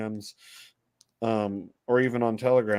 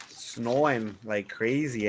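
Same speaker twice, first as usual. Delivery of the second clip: usual speaking voice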